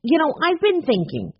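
gushing ice cream maker greeting a friend; lady shopkeeper voice. A narrator's reading. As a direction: call out to him, eager and sociable. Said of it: "Missus Saunders continued".

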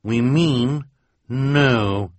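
calm, firm refusal. A narrator's reading. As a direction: stand her ground.

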